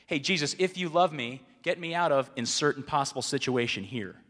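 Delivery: loud, bargaining demand; demanding prayer. Retold or imitated. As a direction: bark the terms at God. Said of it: "what I say to him".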